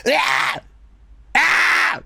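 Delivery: high, harsh yelled takes; imitated recording-session screams; re-enacted yells. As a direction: yell out take after take. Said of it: "just me for, like, twenty minutes just going".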